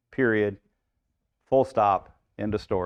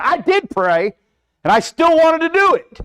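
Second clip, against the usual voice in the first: much higher and louder, mock whining; whiny excuse; 7 dB louder than usual. Preacher mimicking a believer blaming God, hammed-up whine. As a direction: protest like a caught child blaming God.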